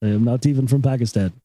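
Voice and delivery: put-on Indian accent, earnest denial; mock Indian accent, pitch sinking; Indian store clerk. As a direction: imitate an Indian clerk correcting a customer. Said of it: "stand behind the counter".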